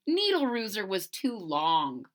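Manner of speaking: ordinary delivery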